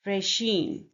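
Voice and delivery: "regime" as ray-ZHEEM, weight on the end; stress on second syllable. Shown as a demonstration. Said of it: "the stress is on the".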